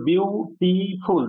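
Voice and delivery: বিউটিফুল stretched as বিউ, টি, ফুল; syllable by syllable. Shown as a demonstration. Teacher counting syllables for the class, বিউটিফুল slowly split in three. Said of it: "তিনটে সি লেভেল".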